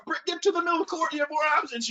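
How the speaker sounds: high, rushed repeated instruction; coaching call. Retold or imitated. Coach repeating his instruction across the court, rushed.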